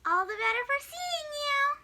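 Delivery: wolf pretending to be grandma; hammed-up old lady voice; fake grandma voice.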